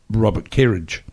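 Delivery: ordinary talk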